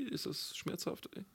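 whispered question. Real, not acted. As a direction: breathe the words, no voice. Unvoiced aside.

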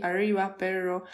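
"Arriba, perro" with an English-style R; untrilled RR in ARRIBA and PERRO, as in English; northern Argentine R. Shown as a demonstration. Showing how her Tucumán husband talks, English-like R. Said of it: "como hacen como R de inglés".

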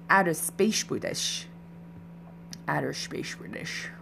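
put-on made-up British accent with slushy s sounds; half-baked Outer Space British accent. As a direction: try an odd, invented British accent. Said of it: "I don't know what accent that was".

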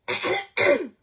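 harsh throat-clearing cough; coughing fit. Real, not acted. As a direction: clear the throat hard, mid-sentence. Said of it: "non riesco a venir fuori da questa tosse". (attacco di tosse)

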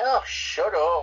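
slow, breathy scorn; mocking a sappy hero with contempt; scornful groan. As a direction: sneer it out on one long breath. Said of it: "And I'm like".

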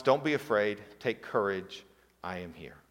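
calm the frightened disciples softly; reassuring Jesus. Retold or imitated.

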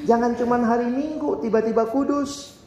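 usual speaking voice

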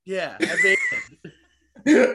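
slow, drawn-out laughing amazing. Real, not acted. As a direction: stretch it out through laughter.

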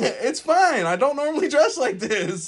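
high, loud, flustered play-acting; mock bashful. Excusing the outfit to an admirer, mock bashful. Playing the bashful, flattered friend.

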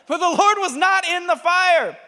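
high, loud scripture cry; dramatic shouted reading. Acted. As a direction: shout the verse at a climax. Dramatic reading at storm's climax.

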